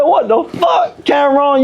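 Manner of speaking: high, slow shouted what the fuck; mock outraged shout. Retelling yelling at his son Cameron, high slow mock shout. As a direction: yell it at the kid in mock outrage.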